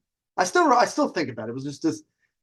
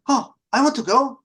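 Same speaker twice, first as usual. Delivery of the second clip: mock eager girl; 9 semitones higher, 4 dB louder than usual. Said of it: "She's like".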